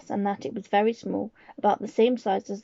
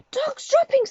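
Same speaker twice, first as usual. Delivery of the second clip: high, loud shout of disgust; 4 dB louder than usual. Acted. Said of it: "they shouted".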